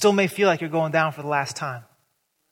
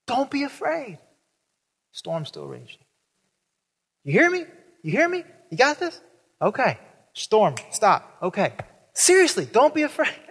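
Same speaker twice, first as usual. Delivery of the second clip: much higher, bouncy checking-in voice; mock Jesus pep talk; 6 semitones higher than usual. Jesus coaching his disciples through the storm, high and playful. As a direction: play it like a cheerful coach.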